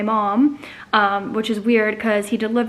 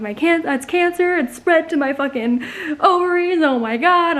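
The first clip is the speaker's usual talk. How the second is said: higher-pitched retold panic, words tumbling out; freaking out; 6 semitones higher than usual. Patient retelling her cancer scare, freaking out. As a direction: replay an inner freak-out, pitch raised. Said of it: "I'm, like, freaking out".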